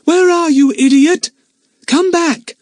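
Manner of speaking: exasperated shout into a tunnel; loud high call. A narrator's reading. Lucy Ann shouting after the lost parrot, high and loud. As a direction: call her back, loud and cross.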